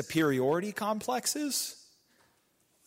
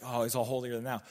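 mock dismissive; 5 semitones lower, 7 dB quieter, faster than usual. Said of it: "people like to use it like".

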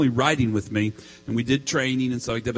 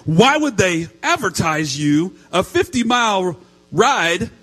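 high, loud, slow indignant outburst; hammed-up furious complaint; mock mad wife; 10 semitones higher, 6 dB louder than usual. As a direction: imitate an outraged wife, climbing higher. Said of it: "Now she's mad".

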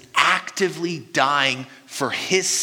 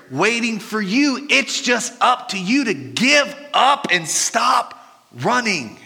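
rising appeal; 6 semitones higher, slower than usual. Real, not acted. Preaching climax.

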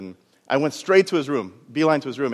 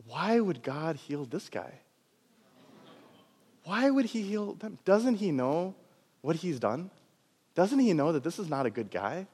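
resentful doubt; 2 semitones higher, 8 dB quieter, slower than usual. Retold or imitated. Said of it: "she had this look of".

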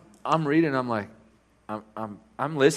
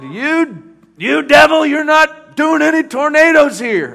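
high, loud, slow commanding rebuke; fiery preacher's roar at the storm; rebuking shout; 13 dB louder, slower than usual. Retold or imitated.

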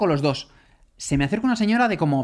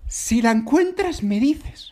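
put-on high, slow eerie old lady; mock-creepy old lady voice; 6 semitones higher, much slower than usual. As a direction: draw it out, eerie and sweet. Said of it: "repeats the lady's line".

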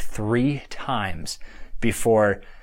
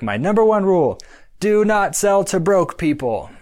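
higher, louder and slow, laying down the law; emphatic rule; 7 semitones higher, 4 dB louder than usual. Retold or imitated. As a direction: deliver it like a mentor's golden rule. Quoting podcast host's rule.

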